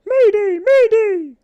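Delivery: very high, loud distress cry; fleeing tick; alarm call. A narrator's reading. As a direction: shout mayday at full force. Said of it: "called a tick as it fled".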